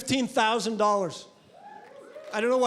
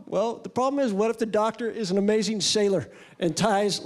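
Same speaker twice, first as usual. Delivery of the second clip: normal talking voice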